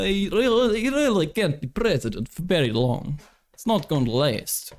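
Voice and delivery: high-pitched mock Russian accent. Streamer mocking worries over Biden's age, high Russian accent. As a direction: fret in an exaggerated Russian accent. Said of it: "I'm clearly Russian".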